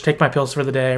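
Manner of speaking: ordinary delivery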